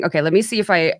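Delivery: ordinary talk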